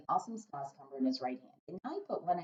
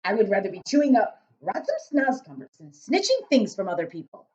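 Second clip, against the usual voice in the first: big loud giant, muddling his words; 4 semitones higher, 10 dB louder than usual. A narrator's reading. Giant grumbling to Sophie about snozcumbers, big booming voice. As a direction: boom it out as a kindly giant.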